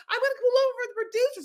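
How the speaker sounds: high, fast, breathless reply; flustered rush. Retold or imitated. New driver obeying producers, flustered and fast. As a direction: blurt it out in a rush.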